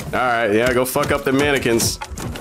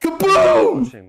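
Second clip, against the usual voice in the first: very high, gleeful "kaboom"; imitated explosion; 14 semitones higher than usual. Streamer voicing the trailer's explosion, imitated explosion. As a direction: sing out the blast high.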